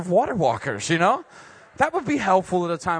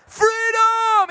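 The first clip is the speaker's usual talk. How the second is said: much higher, loud shouted freedom; battle cry; 8 dB louder than usual. Pretend. Jesus imagined giving Peter a rousing pep talk, shouted. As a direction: bellow it like a war speech. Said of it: "Give him some sort of, like, pep talk".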